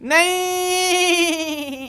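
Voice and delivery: high, wailing whinny of a no; horse voice. Acted. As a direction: cry it out like a hurt horse. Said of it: "Were you hurt during making of this film".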